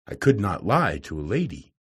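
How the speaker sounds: plain reading voice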